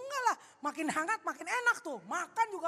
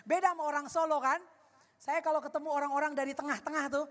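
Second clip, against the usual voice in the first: usual speaking voice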